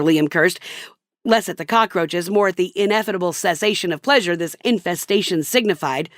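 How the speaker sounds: ordinary delivery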